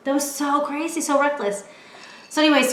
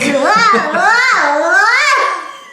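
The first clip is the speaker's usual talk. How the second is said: high, loud repeated ah cries; mock panicked cries; 10 semitones higher, 10 dB louder, much slower than usual. Dragged by the dog on the leash, loud high cries. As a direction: cry out as you're dragged along. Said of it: "I was like".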